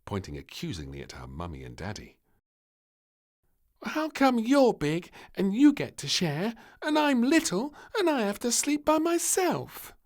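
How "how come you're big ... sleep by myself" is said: put-on much higher and louder, a little girl's complaint; whiny child. Princess complaining to mummy and daddy, high and whiny. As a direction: protest like a jealous small child.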